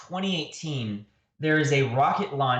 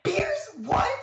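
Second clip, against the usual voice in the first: high squeaky disbelief; incredulous squeak. Retold or imitated. Remembering his own reaction to beer at dawn, high squeak. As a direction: squeak the words in shock. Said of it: "I remember being like".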